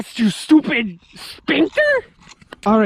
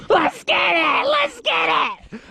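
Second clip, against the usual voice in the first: hyped urging; 7 semitones higher, slower than usual. Real, not acted. Hyping the shot.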